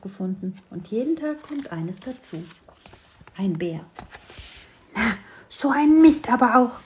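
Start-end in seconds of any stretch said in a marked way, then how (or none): 4.9-6.7 high, loud little grumble; cheeky sparrow cursing at nothing; grumbling sparrow. A narrator's reading. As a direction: scold loudly to yourself like a cross little bird. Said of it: "schimpfte der kleine Spatz lauthals".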